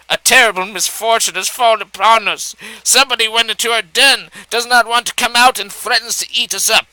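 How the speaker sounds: distressed mother fox. A narrator's reading.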